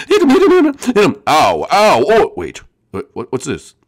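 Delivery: normal talking voice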